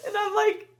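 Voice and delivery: high burst of laughter. Real, not acted. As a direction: squeal with laughter. Laughing at son's claim.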